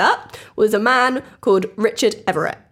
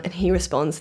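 normal talking voice

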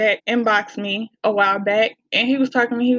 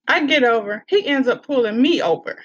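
ordinary talk, nothing marked

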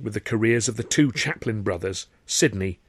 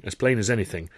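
usual speaking voice

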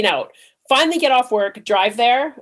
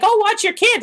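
chirpy stranger voice; 12 semitones higher than usual. Retold or imitated. Stranger's offer retold in Walmart.

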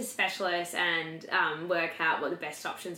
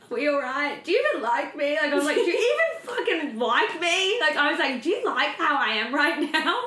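higher and louder, half-laughing plea; mock desperate outburst; 8 semitones higher, 6 dB louder than usual. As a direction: replay the meltdown, pleading and a bit amused. Retold emotional outburst at partner.

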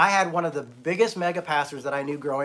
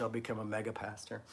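ordinary talk, nothing marked

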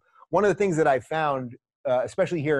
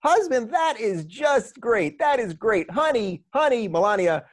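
much higher, slow and gushing, calling out honey; Trump impression; 7 semitones higher than usual. Trump hearing of the husband, mock delighted high call to Melania. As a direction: ham up a delighted Trump calling to his wife. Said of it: "He goes".

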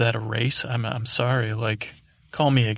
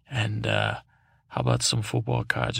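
much lower, coaxing villain; sly villain voice; 11 semitones lower than usual. Acted. Villain tempting the podcaster, low coaxing voice. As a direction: lure him in low and sly. Villain bribing narrator with treats.

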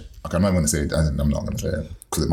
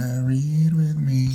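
high, slow, put-on seductive voice; sultry tease; 8 semitones higher than usual. Teasing cohost with a sultry invite to read. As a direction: drawl the invite like a flirt.

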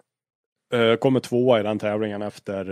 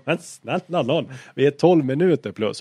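raised, pleading exasperation; higher voice, acted-out disbelief; exasperated reply; 5 semitones higher, 6 dB louder than usual. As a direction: answer back in a retold quarrel, fed up.

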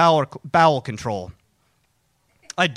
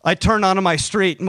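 ordinary delivery

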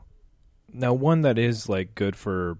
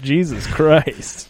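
slow, high, loud Jesus Christ; shocked; 7 semitones higher, 8 dB louder, much slower than usual. Real, not acted. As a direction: react in stunned disbelief.

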